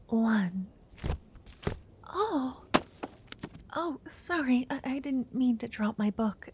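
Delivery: nervous, stammering whisper; flustered and shy, tripping over words; shy crush. Acted. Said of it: "Sorry if I sound nervous".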